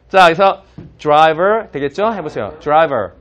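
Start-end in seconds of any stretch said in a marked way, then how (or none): 1.0-1.7 "driver" starting like "jry-ver". Shown as a demonstration. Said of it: "D는 드가 아니라 주". 2.6-3.1 "driver" starting like "jry-ver". Shown as a demonstration. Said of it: "D는 드가 아니라 주".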